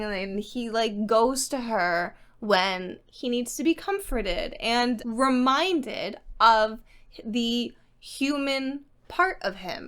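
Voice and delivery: usual speaking voice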